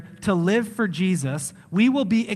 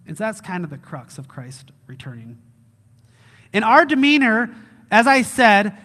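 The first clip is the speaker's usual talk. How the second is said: usual speaking voice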